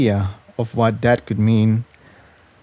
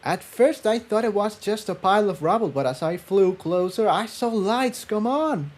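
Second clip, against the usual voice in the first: high, soft, quick and eager; excited filly Tiptoe; 9 semitones higher, 7 dB quieter than usual. A narrator's reading. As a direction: rush the story out, thrilled. Tiptoe's voice in story.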